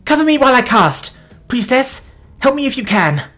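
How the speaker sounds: high, urgent call for help; yelled. A narrator's reading. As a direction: shout instructions to allies. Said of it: "Alora yelled out to them".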